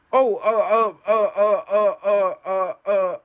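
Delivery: hammed-up nervous stutter; mock flustered stammer. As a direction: imitate a caught wife fumbling for words. Wife caught, voiced by husband.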